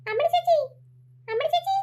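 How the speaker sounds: high calling for his sister; a boy searching for his missing sister. Acted. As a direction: call her name into the woods. Boy calls lost sister.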